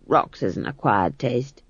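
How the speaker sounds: low slow dry aside; wry old crone voice; dry old witch. Acted. Granny speaks.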